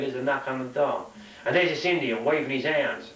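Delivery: ordinary talk, nothing marked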